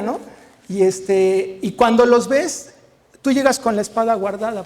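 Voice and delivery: usual speaking voice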